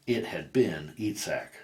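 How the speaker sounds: ordinary delivery, nothing marked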